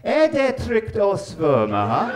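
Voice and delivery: slow, loud, confident "Er det trygt å svømme"; proud tourist Norwegian. Retold or imitated. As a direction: deliver it proudly to the receptionist. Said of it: "And I went".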